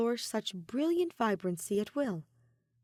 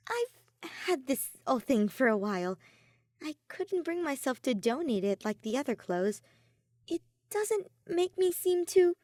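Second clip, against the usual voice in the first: higher, unsure, slowing at the end; Soleil's voice; 6 semitones higher than usual. A narrator's reading. Soleil confessing about her old coat, higher and unsure. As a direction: voice rising as doubt creeps in.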